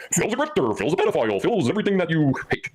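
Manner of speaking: high, mocking troll voice. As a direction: sneer it out like the idiot in chat. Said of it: "that one overwhelming idiot in the live chat saying".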